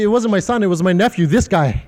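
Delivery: normal talking voice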